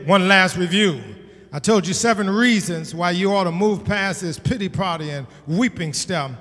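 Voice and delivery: ordinary talk, nothing marked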